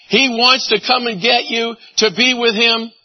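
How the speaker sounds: normal talking voice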